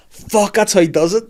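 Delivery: high, loud exasperated outburst; suddenly see the trick and groan it out; dismayed realisation. Pretend. Realising the devil tricked him.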